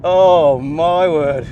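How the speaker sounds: slow, loud, drawn-out amazement; amazed. Real, not acted. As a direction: stretch it out in awe. Thrilled on track.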